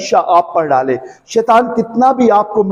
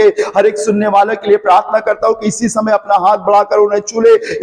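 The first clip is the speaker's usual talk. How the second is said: prayer building into an urgent, higher-pitched plea, easing at the close; fervent prayer; 4 semitones higher than usual. Real, not acted. Preacher praying over his listeners for freedom and healing, fervent prayer. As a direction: pray in a pressing, unbroken stream of petitions.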